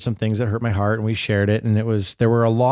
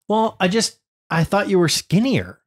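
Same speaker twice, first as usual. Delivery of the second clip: put-on higher, innocent little-girl voice; daughter's voice; 7 semitones higher than usual. Dad repeats his daughter's innocent higher voice. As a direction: lightly play the blunt kid.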